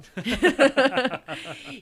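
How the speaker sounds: loud, high burst of laughter; a big laugh at your own aside; real hearty laugh. Real, not acted.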